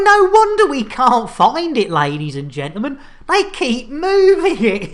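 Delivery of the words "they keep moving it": put-on exasperated Cornish accent, higher and louder. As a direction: cry it out in a hammed Cornish accent. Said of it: "Yeah, great Cornish accent".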